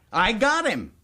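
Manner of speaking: proud boast. Acted. Governor boasting to the boys of his prisoner, proud. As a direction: announce the catch proudly.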